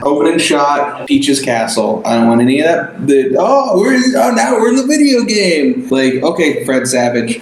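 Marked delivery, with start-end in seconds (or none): 3.4-5.9 high, giddy gushing voice; mock excited fan. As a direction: gush like a dorky gamer. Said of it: "This is fucking".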